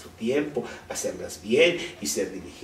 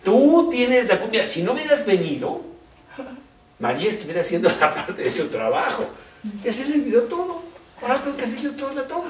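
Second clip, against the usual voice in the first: acusación aguda, más alta y fuerte; Marta furiosa; 9 semitones higher, 6 dB louder, faster than usual. Retold or imitated. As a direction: reclama como hermana harta, atropellada. Marta acusa enojada a Jesús. (Marta reclama a Jesús, enojada y chillona)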